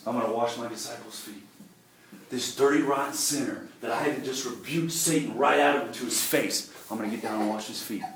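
quiet resolve to serve; humble inner voice. Retold or imitated. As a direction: hush it down, lowly and meek. Voicing Christ's humble thought.

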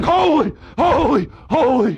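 high, loud cry of holy, each one falling; ringing, falling holy cries. Acted. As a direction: call out like the angels in the temple. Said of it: "And they were crying".

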